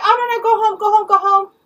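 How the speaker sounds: high, loud mimicry of shooing someone off; high-pitched, impatient imitation; mock dismissal. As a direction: brush them off in a high mocking voice. Mimicking woman shooing her away.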